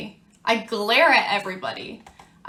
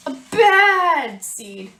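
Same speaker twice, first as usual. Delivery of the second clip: louder, slower bad seed; slow, emphatic bad seed; 5 semitones higher, 7 dB louder, much slower than usual. Teacher reading the seed's turning point, slow and emphatic. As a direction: lean hard on bad.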